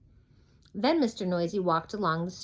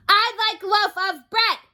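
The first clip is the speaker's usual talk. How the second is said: high, loud boom; 9 dB louder than usual. Acted. Mister Noisy booms at the baker's wife, booming. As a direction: boom out the order. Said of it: "he boomed".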